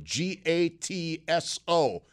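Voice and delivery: slow letter by letter spelling. Shown as a demonstration. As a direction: spell each letter out slowly. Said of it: "I'll spell that".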